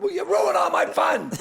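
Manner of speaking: loud, high-pitched comic complaint; mock-indignant retort. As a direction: protest loudly to the spoilsport. Retold comic retort to wife.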